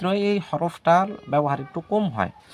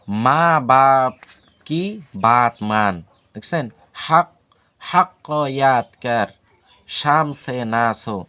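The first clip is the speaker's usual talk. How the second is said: slow reading; 4 dB louder, much slower than usual. Shown as a demonstration. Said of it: "কয়টা বাক্য আপনাদেরকে বলি".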